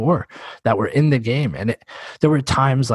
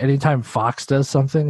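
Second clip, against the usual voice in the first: usual speaking voice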